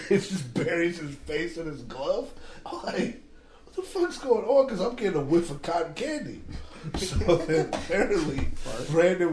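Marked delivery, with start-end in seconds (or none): 3.6-4.7 much higher, bewildered mock exclamation; baffled outburst. Confused infielder asking his teammates, high baffled outburst. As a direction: throw the question out in disbelief.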